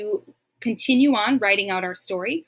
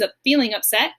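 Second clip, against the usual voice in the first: ordinary talk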